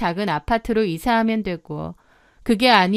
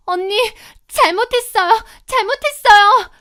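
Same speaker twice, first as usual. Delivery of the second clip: very high-pitched begging, growing louder; desperate pleading; 12 semitones higher, 6 dB louder than usual. Acted. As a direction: beg frantically for forgiveness while held by the hair. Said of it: "무릎 꿇고 빌기 시작하는 겁니다". (다급하게 싹싹 비는 목소리)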